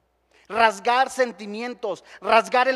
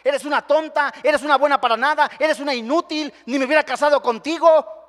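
louder mocking husband berating his wife; scornful husband; 4 semitones higher, 6 dB louder than usual. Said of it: "muchas personas están hablándole a su esposa".

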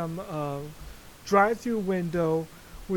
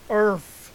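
"Earth" blurted loud as a lone word; emphatic EARTH; 2 semitones higher, 9 dB louder than usual. Shown as a demonstration. Poking fun at his own emphasis, loud "Earth". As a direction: hit "Earth" hard and loud. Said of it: "I put the emphasis on earth".

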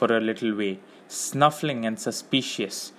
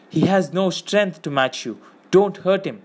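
raised, earnest protest; pleading; 6 semitones higher than usual. A narrator's reading. Frodo to the men holding Gollum, pleading. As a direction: plead on the captive's behalf.

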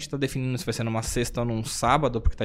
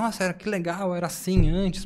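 higher-pitched retold wonder; imitated amazement; 5 semitones higher than usual. Newcomer learning of the old monthly events, imitated amazement. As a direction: lift the pitch, quoting someone pleasantly surprised.

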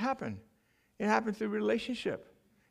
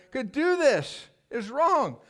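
raised pitch, mildly tearful re-enactment; retold tearful protest; 7 semitones higher, much slower than usual. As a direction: replay a weeping protest without sobs. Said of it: "I'm weeping".

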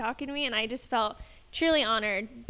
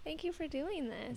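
whisper; 3 semitones higher, 11 dB quieter than usual. Real, not acted. Tender thanks to friend.

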